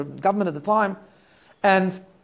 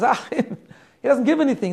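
retold laugh, a bit slower and higher; 5 semitones higher, slower than usual. Community president laughing at the Rebbe's question, mock amused. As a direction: chuckle through it as if the idea is absurd. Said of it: "He started to laugh".

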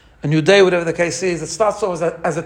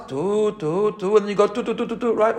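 soft tu tu, then quicker tu tu tu; shvarim then truah blasts; 2 semitones higher, 4 dB quieter than usual. Shown as a demonstration. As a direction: longer notes first, then quick ones. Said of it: "you start off with the longer ones".

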